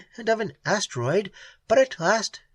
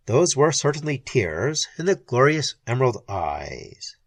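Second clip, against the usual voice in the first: plain reading voice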